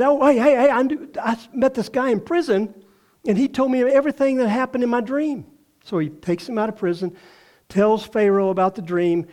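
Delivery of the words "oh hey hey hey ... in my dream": excited butler voice; eager retelling. Retold or imitated. Butler eagerly reports to Pharaoh.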